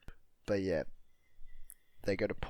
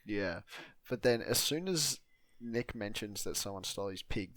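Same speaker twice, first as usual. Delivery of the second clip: usual speaking voice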